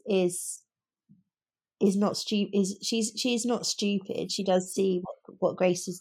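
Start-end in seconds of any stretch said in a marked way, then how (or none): none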